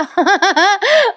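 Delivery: high happy laugh; laugh, enjoying the scene; real laugh. Real, not acted.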